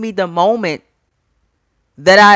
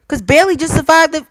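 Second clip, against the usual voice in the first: ordinary delivery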